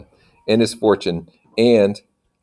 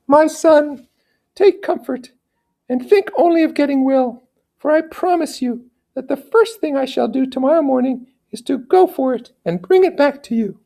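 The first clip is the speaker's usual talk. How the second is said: soothing her sick son in a much higher voice; high gentle lady voice. Acted. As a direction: promise it like a loving noble mother. Mother answers her son.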